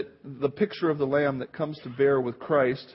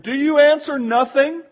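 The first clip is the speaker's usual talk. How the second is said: high, loud demanding question; pressing the silent prisoner hard; high priest's demand; 9 semitones higher, 9 dB louder than usual. Retold or imitated. High priest demands Jesus answer him, loud and high.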